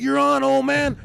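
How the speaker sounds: loud accepting-the-challenge shout. Retold or imitated. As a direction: yell it, high and loud. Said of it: "I was like".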